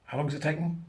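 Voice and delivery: soft, choked question; emotional. Real, not acted. Moved by the debate, soft choked question. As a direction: ask it close to tears. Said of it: "I'm getting emotional again".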